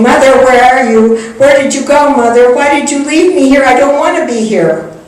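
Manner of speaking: put-on high, loud crying call of a lost little girl; a little girl knocking at the gate, begging for her mother; pleading child. Little girl in vision calling.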